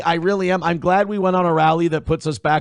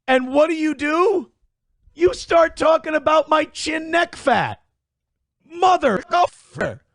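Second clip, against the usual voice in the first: mock outrage; 8 semitones higher than usual.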